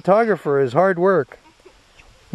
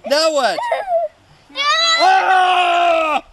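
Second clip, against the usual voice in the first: squealing laughter while tickled; being tickled; 12 semitones higher, much slower than usual. Real, not acted.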